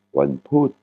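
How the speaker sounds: Wanput with a short "oo" on a falling last syllable; short vowel, falling tone. Shown as a demonstration. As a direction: drop the tone on "put" in Wanput. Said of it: "une intonation qui tombe". (Wanput, « ou » court, intonation qui tombe)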